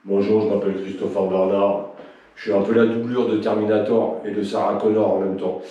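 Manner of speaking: voix plus grave, posée et monocorde; imitation de Christo. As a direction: imite un collègue gentil et un peu raide, plus bas. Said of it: "Que Christo, il est pas drôle?". (imitation moqueuse de Christo, voix plus grave)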